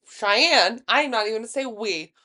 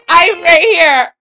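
slow, higher, much louder yell; drunken shout; 7 semitones higher, 14 dB louder, slower than usual. Retold or imitated. Drunk friend yelling she is right there. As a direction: drag it out, indignant.

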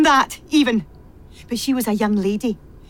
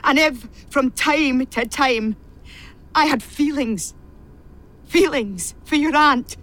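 ordinary talk, nothing marked

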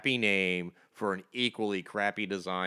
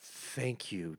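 normal talking voice

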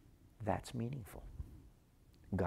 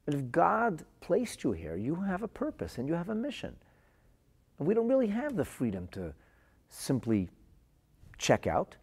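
earnest urging; 7 semitones higher than usual. Retold or imitated. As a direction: plead with her to stay, voice raised. Physician pleads with suicidal girl.

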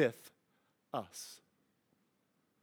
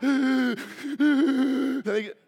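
1 imitated child's gasp; 6 semitones higher, 4 dB louder than usual. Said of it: "they're doing that shake-, shaky cry".

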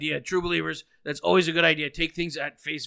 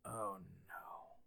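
whispered; 7 semitones lower, 22 dB quieter than usual. Real, not acted. Host dreads known caller.

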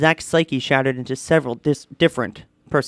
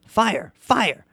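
smoke detector shouting; 6 semitones higher than usual. A narrator's reading. Talking smoke detector warning from the wall, mock shout. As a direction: call it out twice like an alarm. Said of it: "the infernal disc shouted".

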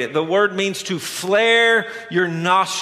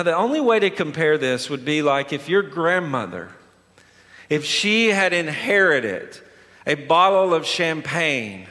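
ordinary delivery

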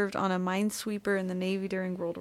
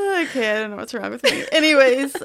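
laughing; 4 semitones higher, 9 dB louder than usual. Real, not acted. Recovering from giggle fit.

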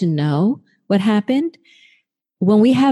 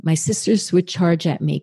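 normal talking voice

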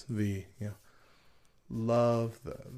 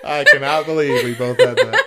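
laughing disbelief; 3 semitones higher, 10 dB louder than usual. Real, not acted. Co-hosts asked the same question at once, laughing disbelief. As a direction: burst out laughing while saying it.

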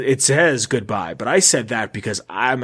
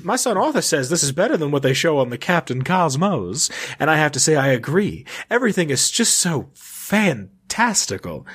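highfalutin fancy lady. Acted. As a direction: play a lady very full of herself. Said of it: "very highfalutin, like, just full of themselves".

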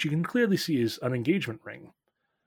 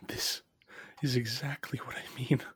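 hushed aside; 8 dB quieter than usual. Acted. Dwarf looking back at Lonlin, hushed. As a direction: say it under your breath.

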